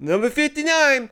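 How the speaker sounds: high bright shop call; calling a number. Retold or imitated. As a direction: sing out the ticket number. Calling out deli order.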